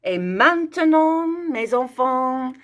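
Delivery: high, slow, sing-song call to the class; playful French announcement. Acted. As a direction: announce it like a show host to the children.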